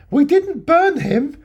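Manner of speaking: high, slow, creepy shop-lady voice, laughing through it; drawn-out suspicious innocence; Tubbs voice. Retold or imitated. Tubbs blurting to the policeman, high and slow. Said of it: "the first thing she says is".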